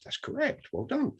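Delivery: very high, soft reply; tiny high-pitched, hushed praise; coy congratulation. Retold or imitated. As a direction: squeak out a quiet well done. Retold coy reply to presenter.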